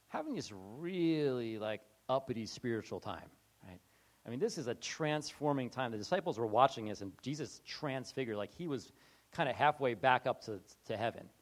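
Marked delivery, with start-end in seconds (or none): none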